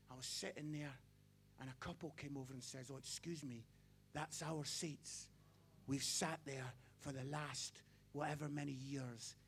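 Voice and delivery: low, soft storytelling; hushed sad retelling. Acted. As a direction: draw them in, low and sad.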